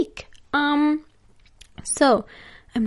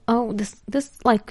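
ordinary talk, nothing marked

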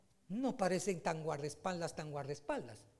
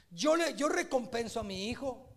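higher and louder, insistent; fervent preaching; 5 semitones higher, 6 dB louder than usual. Acted. Pastor describing a father rewarding his son, fervent preaching. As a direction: keep the pulpit heat.

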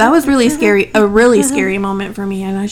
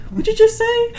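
surprised; 12 semitones higher than usual. Retold or imitated. Retold shocked reaction.

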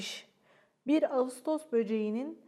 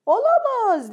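much higher and louder, playful alarm; a hammed-up gasp of oops; dad pretending to be shocked; mock dismay; 10 semitones higher, 10 dB louder, slower than usual. A narrator's reading. Father realizes forgotten windows.

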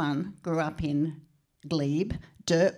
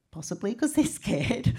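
ordinary talk

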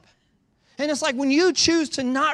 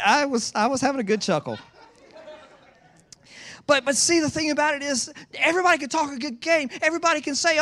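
usual speaking voice

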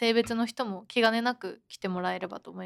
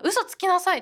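higher, louder snap of an accusing teacher; mock scolding teacher; 7 semitones higher, 5 dB louder than usual. Teacher scolding the student for lying, in a mock snap. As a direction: play the teacher calling her a liar.

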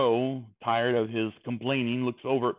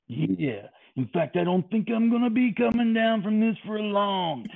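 hammed-up wrestler with high, swooping yeahs; over-the-top wrestling showman impression; wrestling promo; 5 semitones higher than usual. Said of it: "almost as someone cutting a promo".

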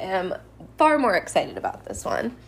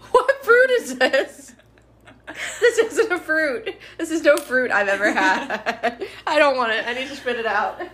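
high, laughing, grossed out; laughing disgust; 5 semitones higher, 4 dB louder than usual. Real, not acted. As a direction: laugh through real disgust.

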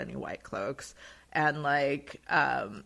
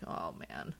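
mock dismay; 10 semitones lower, 5 dB quieter than usual. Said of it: "they kind of like".

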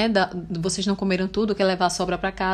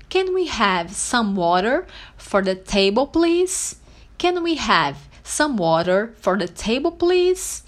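model phrase; slower than usual. Shown as a demonstration. Teacher modelling asking for water, slow with lifted please. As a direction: enunciate each chunk, please pitched up. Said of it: "você pode dizer assim".

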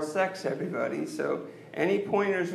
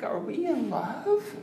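usual speaking voice

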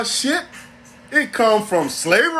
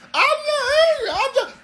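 extremely high, frantic denial; hammed-up squeaky protest to the master; panicked slave. Slave denies anger to master.

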